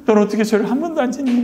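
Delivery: high, whimpering imitation of crying; re-enacted sobbing lament; mock tearful wail. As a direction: wail enviously at the grass. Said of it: "잔디를 붙잡고 막 웁니다".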